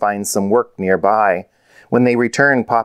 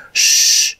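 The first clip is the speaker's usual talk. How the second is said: long voiceless shush; hushing shh. A narrator's reading. Rosie hushes her sister while searching, shushing. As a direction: hush the others to listen. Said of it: "whispered Rosie".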